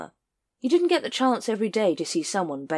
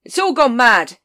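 loud, raised exclamation; shouting over the crowd; 7 semitones higher, 11 dB louder than usual. Acted. Vimes shouting to Carrot over the crowd. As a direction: call it out above the noise. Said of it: "he shouted".

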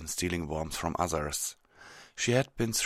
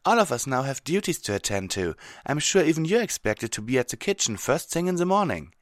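higher, louder character voice; lightly raised, practical mare's voice; reasoning Twilight; 9 semitones higher, 5 dB louder, faster than usual. Acted. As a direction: explain it firmly, pitched up.

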